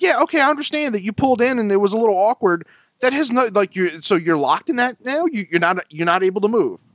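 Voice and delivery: retold sarcastic comeback; exasperated sarcasm. Driver answering the truck owner's excuse, exasperated.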